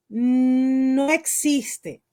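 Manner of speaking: drawn-out NO, loud and held: "no existe"; emphatic denial. Real, not acted. Actress states firmly to interviewer that neutral accent doesn't exist, emphatic denial. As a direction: stretch "no" long and loud before "existe".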